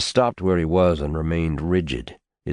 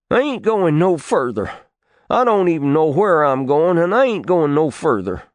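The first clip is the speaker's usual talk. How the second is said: a defiant country boy, voiced higher; stubborn boy refusing; 10 semitones higher, 4 dB louder than usual. Tarwater refusing to follow his uncle, defiant boy voice. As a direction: dig in your heels and refuse, sulky.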